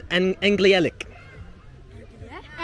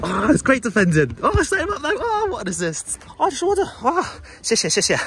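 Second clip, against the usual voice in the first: excited; 11 semitones higher than usual. Real, not acted. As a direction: shout it out caught up in the match. Playing football, excited shouts.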